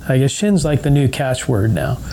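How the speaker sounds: normal talking voice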